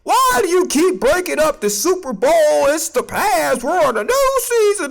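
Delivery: high, whiny mock of the critics. Mocking the critics who tell him to move on, high and whiny. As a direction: mimic the nagging doubters. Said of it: "what people are going to say".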